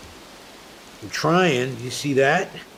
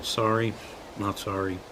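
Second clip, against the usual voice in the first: trying out the phrase; 6 semitones lower, 8 dB quieter than usual. Acted. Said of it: "That's new material".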